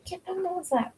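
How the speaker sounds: high, soft pet voice; coaxing the dog. Real, not acted. Inviting the dog onto her lap, high soft coo.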